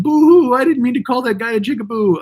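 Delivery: high, loud mock boo-hoo whine; hammed-up sobbing YouTuber; fake crying apology. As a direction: sneer at a fake teary sob. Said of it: "fake teary apologies".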